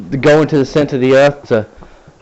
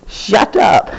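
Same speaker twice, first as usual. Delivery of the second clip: higher, sharp playful snap; 8 semitones higher than usual. Real, not acted. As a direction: cut him off jokingly. Snapping at Darren.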